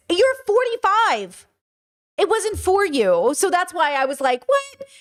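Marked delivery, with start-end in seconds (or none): 0.1-3.3 high, drawn-out disbelief; incredulous scolding. Acted. Streamer scolding grown critics of a kids' cartoon, incredulous. 4.5-4.9 very high-pitched mock bafflement; baffled reaction. Streamer replaying their reaction to adult critics, baffled.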